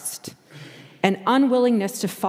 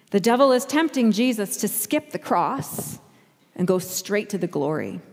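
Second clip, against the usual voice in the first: normal talking voice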